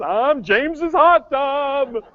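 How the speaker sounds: high, loud, very slow announcing voice; hot tub voice. Pretend. Hot tub introducing itself to the repair woman, high loud and very slow. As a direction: draw out the hot tub's proud introduction.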